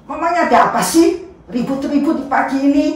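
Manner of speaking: high, loud, fast annoyed question; a fast, annoyed Pilate asking about the commotion; irritated governor. Acted. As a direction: demand what the racket is about.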